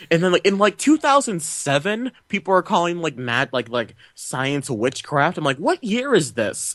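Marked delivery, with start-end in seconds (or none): 5.6-6.7 slow, high-pitched exasperated question; incredulous. Retold or imitated. Viewer baffled by science being called witchcraft, incredulous. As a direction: stretch it out high in disbelief. Mock disbelief at film's plot.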